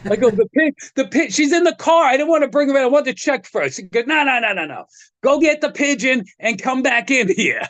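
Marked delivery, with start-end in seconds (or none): 0.3-3.8 high, stumbling excuse; retold hurried self-justification; flustered explaining. 4.1-7.6 high-pitched, brushing-off insistence; retold friend overruling an excuse; insistent order.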